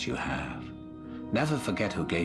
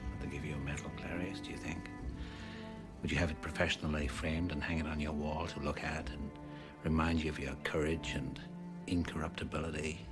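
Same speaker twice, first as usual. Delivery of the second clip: hushed, measured menace; soft taunt; 8 dB quieter than usual. Acted. Villain taunting Clarice about the FBI, soft and hushed. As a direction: speak softly, almost confidingly.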